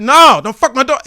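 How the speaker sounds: loud, high shouted warning; furious dad. Retold or imitated. Dad warning daughter's boyfriend, loud high shout. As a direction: bellow it at the boy. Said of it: "I said".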